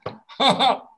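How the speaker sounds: high, loud laughing ha ha; laughing demo. Shown as a demonstration. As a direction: let the laugh bounce. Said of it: "Okay, try that".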